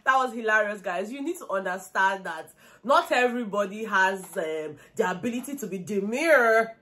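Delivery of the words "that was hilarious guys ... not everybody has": normal talking voice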